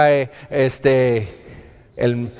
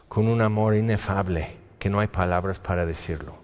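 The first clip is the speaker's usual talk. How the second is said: much softer, lower, awed; tender hush; 6 semitones lower, 7 dB quieter, faster than usual. Real, not acted. Preacher telling the congregation of God's love, softly awed.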